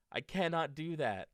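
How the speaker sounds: high, loud protest; upset. Acted. Toad refusing to run after his list, loud protest.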